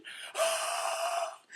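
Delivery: long, high mock gasp; drawn-out squeaky gasp; exaggerated excited gasp. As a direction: suck in air in overjoyed shock, hammed-up. Part of the squealing impression.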